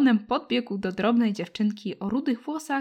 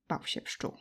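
hushed; 12 dB quieter than usual. A narrator's reading. Narrator hushes fear line.

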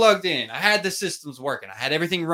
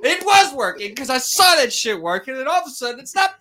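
higher, louder exasperated rant; fired-up retold outburst, pitched way up; heated retelling; 10 semitones higher, 6 dB louder than usual. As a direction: relive the fury of the dead mic. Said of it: "And I was heated".

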